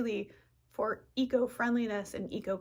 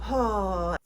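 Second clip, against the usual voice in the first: long audible sigh then a relieved oh; big sigh; 7 dB louder than usual. Shown as a demonstration. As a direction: let it all out with a sigh. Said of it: "take a big sigh and go".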